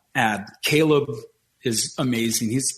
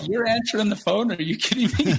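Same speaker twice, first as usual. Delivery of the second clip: amazed disbelief; 8 semitones higher than usual. Retold or imitated. His own disbelief retold.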